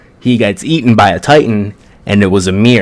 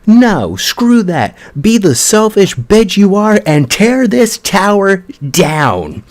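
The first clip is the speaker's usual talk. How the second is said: higher, furious yelling at her friend, slowed at the end; hammed-up scream of frustration, pitched up; mock angry scream; 9 semitones higher than usual. Krista on the tower ledge screaming down at Ymir: higher, angry mock yell. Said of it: "just starts screaming at her".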